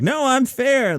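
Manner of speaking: high, mock-defensive Joe; imitating an oversteering host, pitched high. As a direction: squeak out a defensive protest. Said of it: "being like".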